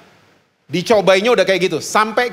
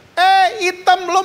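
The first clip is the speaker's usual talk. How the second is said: high mocking teen voice calling out; mocking schoolkid yell; 13 semitones higher, slower than usual. Schoolmate teasing his daughter across the yard, high mocking call. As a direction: taunt her in a high teenage voice. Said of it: "temennya teriak gini".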